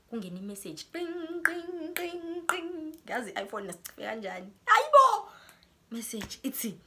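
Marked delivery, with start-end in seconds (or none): 0.9-2.9 high sing-song ding ding like a phone alert; imitated message chime. 4.7-5.3 sudden very high, loud cry of disbelief; shocked exclamation. Real, not acted. Shocked by incoming message.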